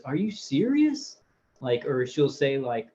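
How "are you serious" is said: incredulous. Retold or imitated. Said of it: "And he goes".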